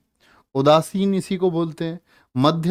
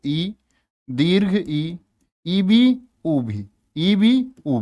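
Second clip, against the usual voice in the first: drawn-out vowel demo; much slower than usual. Shown as a demonstration. Teacher drawing out vowel sounds.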